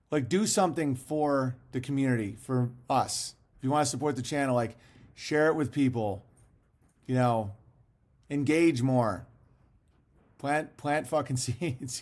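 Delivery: ordinary talk, nothing marked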